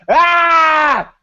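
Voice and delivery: high joyful yell; yelling as the belt comes out; retold shout of joy. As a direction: let out a triumphant shout. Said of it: "I'm like".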